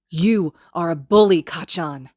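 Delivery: loud, angry accusation; spat out. Acted. Izuku finally fighting back against Kacchan, spitting angrily. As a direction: spit the word bully at him.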